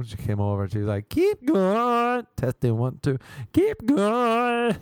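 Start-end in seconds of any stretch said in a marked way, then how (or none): 1.1-4.8 high, loud braying "keep going" into a headset; donkey testing a microphone. Retold or imitated. Yoga teacher on her headset to the class, donkey-like high bray. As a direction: bray it like a donkey checking a mic. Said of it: "Like a donkey testing a microphone".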